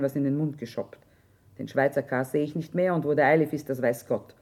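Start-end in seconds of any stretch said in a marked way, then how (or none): none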